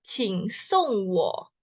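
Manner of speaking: "Qing song wo" drawn out slowly; slow Mandarin. Shown as a demonstration. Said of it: "itu cara bacanya". (pelafalan Mandarin pelan)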